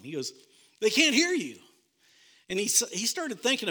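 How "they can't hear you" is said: "They can't hear you" pitched high and louder, fed up; the wife finally losing patience; exasperated wife. Retold or imitated. Wife telling her husband the refs can't hear him, exasperated high.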